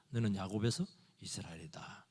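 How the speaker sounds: hushed whisper of God; God whispering to Jacob. Retold or imitated. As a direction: whisper the new name solemnly.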